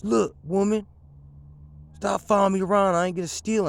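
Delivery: loud, high, irritated telling-off; annoyed movie character. Retold or imitated. Customer telling the store owner to stop following him, loud and irritated. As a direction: snap at her to quit following him. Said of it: "he looks at her and he goes".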